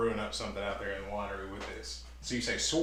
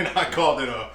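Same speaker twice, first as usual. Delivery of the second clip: laughing; 6 dB louder than usual. Real, not acted. Laughing at own word.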